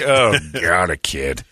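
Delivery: annoyed trucker voice. Retold or imitated. Trucker reacting to kid.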